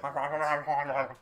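loud, garbled imitation of a microphone; noisy mouth-made mic sound; mic impression. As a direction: blurt out a burst of mic noise. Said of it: "was doing my mic impression".

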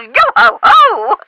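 high, drawn-out yo-ho-ho; mock pirate cheer. Professor amused by the pirate question, hammy high pirate cheer. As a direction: sing out a playful pirate call, slowly.

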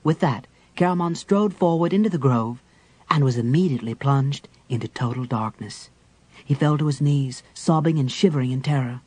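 ordinary delivery, nothing marked

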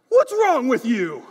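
loud, slow exasperated yell; retold yell at baby. Father yelling at his newborn son, exasperated loud yell. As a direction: snap at the baby in frustration. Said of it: "Why are you yelling at your baby?".

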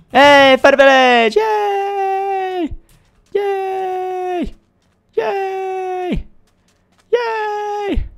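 high, loud greeting into drawn-out yays; mock celebration. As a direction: cheer in a silly high voice. Acts out greeting the village.

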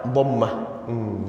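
usual speaking voice

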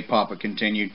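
ordinary delivery

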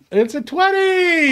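high, drawn-out triumphant shout; thrilled, high-pitched whoop of victory; excited cheer. Real, not acted. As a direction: shout it out like you just won. Player cheers a natural twenty roll.

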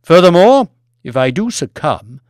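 loud, forceful harangue; demanding lord. Acted. Lord demands Banks look after the people, loud harangue. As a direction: drive each point home loudly. Plays Lord Martin promising inheritance.

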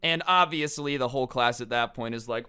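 ordinary delivery

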